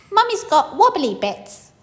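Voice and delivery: put-on high, loud toddler voice; cheeky little baby.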